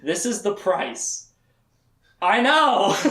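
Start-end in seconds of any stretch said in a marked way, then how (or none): 2.2-3.0 exclaiming. Real, not acted. Reacting to absurd wedding rule.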